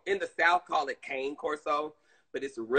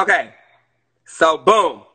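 ordinary talk, nothing marked

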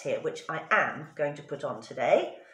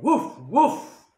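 higher, louder barking; dog barks; 6 semitones higher, 8 dB louder than usual. A narrator's reading. Dog scares the robbers, loud barking. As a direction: bark like a fierce dog. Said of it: "The dog said".

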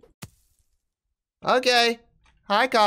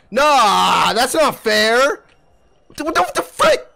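louder, higher protest; indignant; 3 semitones higher, 5 dB louder, much slower than usual. Real, not acted. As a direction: complain it's unfair. Complains enemy has weapon.

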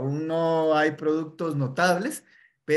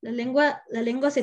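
high mock tongue twist; 10 semitones higher, 3 dB louder than usual. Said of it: "se le traba la lengua".

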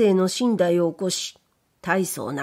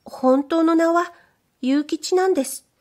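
higher, bashful maid's voice; shy young maid; 9 semitones higher than usual. Acted. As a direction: play the timid young servant girl. Maid confides her real name.

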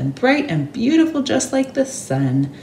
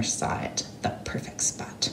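hushed whisper, a secret find; whispered reveal; 13 dB quieter than usual. A narrator's reading. Narrator revealing the perfect spot in a whisper. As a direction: breathe it like a secret discovery.

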